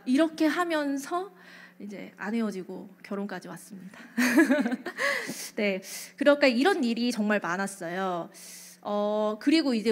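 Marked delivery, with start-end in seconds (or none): 4.2-5.5 short real laugh, falling; a bright laugh that trails down. Real, not acted. After revealing she married him, laughing.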